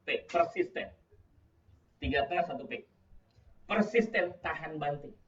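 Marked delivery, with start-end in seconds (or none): none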